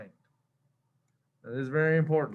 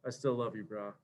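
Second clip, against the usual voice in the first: much softer, lower, soothing bro; tender reassurance; 7 semitones lower, 10 dB quieter than usual. Retold or imitated. Compiler forgiving the coder, soft and low. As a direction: comfort a buddy gently. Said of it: "He's like".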